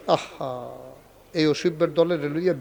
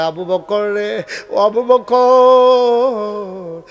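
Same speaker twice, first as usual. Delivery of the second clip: tender drawn call; 5 semitones higher, 7 dB louder, much slower than usual. Acted. Prophet tenderly calls Abu Bakr.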